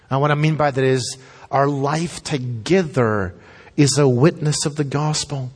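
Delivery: usual speaking voice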